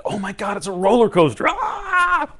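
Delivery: high, loud ride excitement ending in a scream; thrilled scream. Acted. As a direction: blurt it out, building to a shriek. Voicing a roller coaster thrill.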